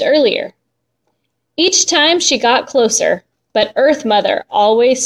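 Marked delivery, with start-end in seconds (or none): none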